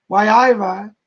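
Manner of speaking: put-on Geordie accent. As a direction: toss out a mock Geordie greeting. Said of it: "talking about Geordie speak".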